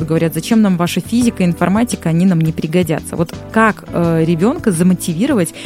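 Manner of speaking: usual speaking voice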